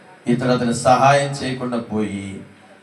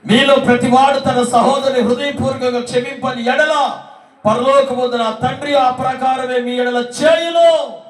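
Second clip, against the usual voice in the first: preacher thundering the Bible verse; preaching a verse; 11 semitones higher, 4 dB louder than usual. Acted. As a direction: proclaim the verse to the congregation. Reads the verse aloud.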